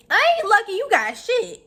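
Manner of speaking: high, loud indignant retort; incredulous mock outrage. To the rival about Jesus, high loud incredulous retort. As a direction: scoff at her like it's absurd.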